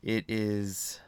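drained and worn out; sad and decrepit. Real, not acted. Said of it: "you sounded very sad and decrepit".

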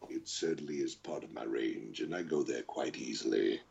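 very low and soft, right on the mic; deep villain voice. Shown as a demonstration. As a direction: eat the mic, drop to the bottom of the range. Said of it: "where I'm right on top of the microphone".

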